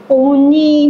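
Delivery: high, sugary call for mother; sweet cooing voice. Retold or imitated. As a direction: coo it sweetly to win her over. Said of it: "완전 사뭇 다른 목소리로". (높고 애교 섞인 목소리로 부르는 "어머니")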